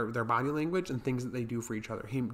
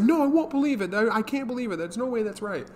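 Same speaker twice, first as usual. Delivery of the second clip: high, loud, panicky refusal; frantic disbelief; 9 semitones higher, 4 dB louder than usual. Retold or imitated. Kijino refusing to believe Taro, high panicked denial. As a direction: wail it out like he can't accept it.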